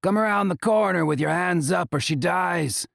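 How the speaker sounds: high, commanding yell; shouted threat. A narrator's reading. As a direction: bark the order at the guards. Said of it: "Acheta roars".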